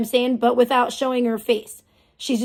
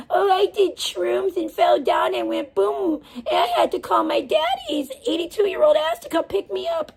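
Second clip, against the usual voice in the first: mocking whiny voice; 7 semitones higher than usual. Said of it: "That whole story about".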